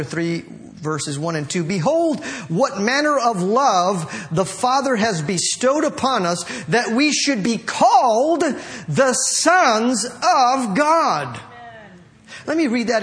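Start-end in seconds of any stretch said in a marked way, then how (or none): 1.6-11.4 raised, ringing declamation of a verse; scripture reading. Acted. Preacher reads a verse to the congregation, solemn proclamation. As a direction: proclaim the verse, stretching the final phrase.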